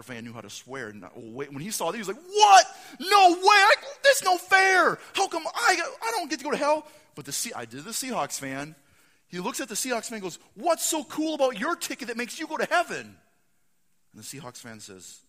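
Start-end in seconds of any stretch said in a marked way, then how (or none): 2.2-6.9 very high, very loud disbelieving yell; outraged shout. Acted. Packers fan seeing his ticket says hell, outraged shouting. 10.5-13.2 high, loud accusing demand; indignant challenge. Acted. Packers fan confronting Seahawks fan over ticket, loud indignant challenge.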